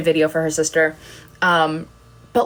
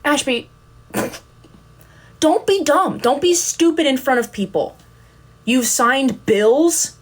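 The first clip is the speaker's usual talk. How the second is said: higher mock scold at herself; self-scolding inner voice; 7 semitones higher than usual. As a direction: tell yourself off, half laughing.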